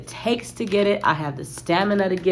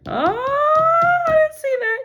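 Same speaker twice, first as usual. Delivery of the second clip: very high, loud surprised squeal; 4 dB louder, slower than usual. Real, not acted. Reader spotting an unexpected card, high surprised squeal.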